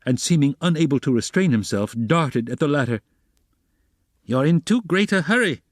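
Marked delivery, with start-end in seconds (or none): 4.2-5.6 high-pitched, irritated exclamation; almost angry shout. A narrator's reading. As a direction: raise the pitch as if shouting, with a touch of anger. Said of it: "he shouted at him, almost angrily".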